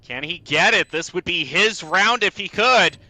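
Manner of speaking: loud, rising hype call; excited play-by-play. Real, not acted. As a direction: build the ace tension. Caster calls near ace.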